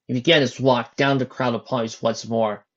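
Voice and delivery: usual speaking voice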